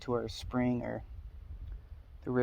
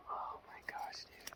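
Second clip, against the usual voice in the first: wildlife watching whisper; 13 dB quieter, slower than usual. Real, not acted. Whispering near the deer.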